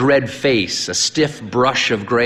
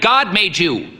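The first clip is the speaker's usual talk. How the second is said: high, curt answer; said shortly; 9 semitones higher than usual. A narrator's reading. Old priest answering his own question, short. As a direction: clip it off briskly. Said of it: "the priest said shortly".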